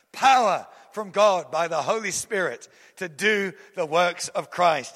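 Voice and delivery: ordinary talk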